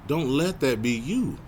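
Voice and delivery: ordinary talk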